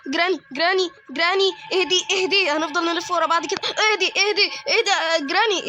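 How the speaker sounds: high, loud laughing pleas to calm down; frantic shouted calm down; frantic pleading. Real, not acted. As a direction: beg her to calm down, half laughing. Neighbor chasing him round.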